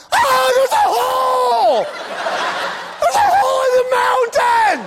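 high drawn-out mock scream; hammed-up terror, shouted at a very high pitch; panicked driver. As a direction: scream in exaggerated alarm as a clueless driver. Acting a panicked, clueless driver.

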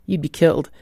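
ordinary delivery

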